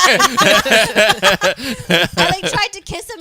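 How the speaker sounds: real laughter breaking into the words; giggling loudly while telling it; laughing through words. Real, not acted. Laughing hard while telling friends about the kiss.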